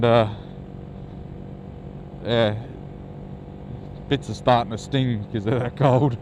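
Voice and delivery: ordinary talk, nothing marked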